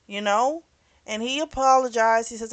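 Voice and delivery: ordinary delivery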